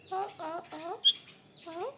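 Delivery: ordinary talk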